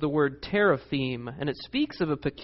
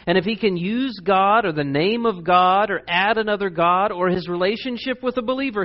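ordinary delivery

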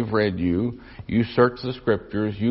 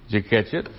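usual speaking voice

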